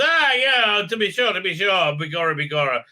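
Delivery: mock Irish brogue for comic effect; mock Irish accent. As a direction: lay on a cartoon Irish lilt. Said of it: "an Irish accent".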